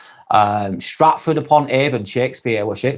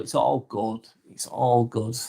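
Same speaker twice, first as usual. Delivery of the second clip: ordinary talk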